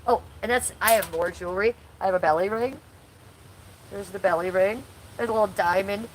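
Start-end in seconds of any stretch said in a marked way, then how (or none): none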